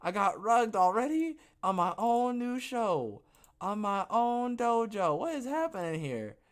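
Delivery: high, loud mock alarm; mock dismay. Host to viewers over a glitched new show, mock dismay. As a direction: cry out in exaggerated disbelief.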